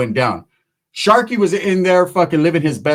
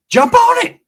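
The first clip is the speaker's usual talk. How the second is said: sudden high, loud yell; excited shout; 6 dB louder, slower than usual. Acted. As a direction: shout it out as a punchline. Punchline advice after slow build-up.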